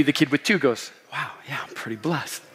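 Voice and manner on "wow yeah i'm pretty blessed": much softer, humbled realization; dawning gratitude. Acted. Kid with two gifts realizes his blessing, humbled. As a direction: say it small and quiet, as it sinks in.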